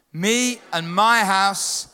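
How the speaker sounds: slow, drawn-out congregational chant; slow unison declaration. Shown as a demonstration. Preacher leads the church in declaring the verse, slowly chanted. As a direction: declare it together, each word stretched. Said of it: "We're gonna declare the family business together".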